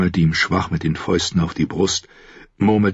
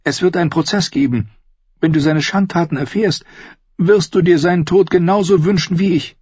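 much higher woman's voice, pressing; insistent Cersei; 12 semitones higher, 3 dB louder than usual. Acted. Sister pushing her brother to kill Tyrion, high insistent voice. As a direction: press him urgently in a high voice.

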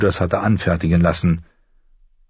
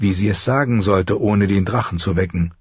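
plain reading voice

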